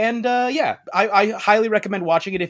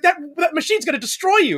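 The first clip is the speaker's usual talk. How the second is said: urgent warning; 14 semitones higher, faster than usual. Retold or imitated. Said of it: "She's like".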